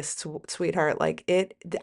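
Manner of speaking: normal talking voice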